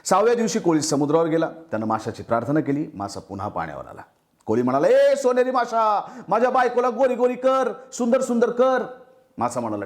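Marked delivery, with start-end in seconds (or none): 4.9-8.8 fisherman calling out. Acted. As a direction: stretch the call over the sea to the fish. Fisherman calls the golden fish.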